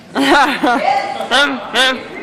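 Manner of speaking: high laughing burst; giggling high; giggle. Real, not acted. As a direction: crack up at the rice joke. Laughs at own joke.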